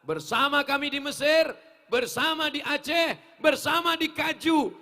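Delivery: loud, high preacher's cry; rousing call. Real, not acted. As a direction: call out each place to the crowd, building. Rousing the congregation in prayer.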